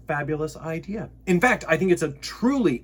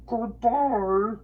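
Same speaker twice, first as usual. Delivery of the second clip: echo; 9 semitones higher than usual. Acted. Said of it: "his own voice echoed off".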